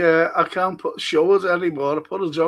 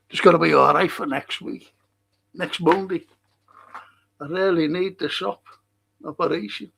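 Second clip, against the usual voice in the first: usual speaking voice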